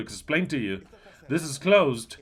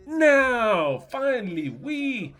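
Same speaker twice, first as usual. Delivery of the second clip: high, excited celebration; mock joyful cheer; 9 semitones higher, 3 dB louder, much slower than usual. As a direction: cheer like a champion. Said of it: "like Messi was telling his wife".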